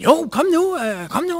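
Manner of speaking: high, insistent coaxing; pleading pushy seller. Retold or imitated. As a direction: wheedle him into buying. Second stranger pressures him.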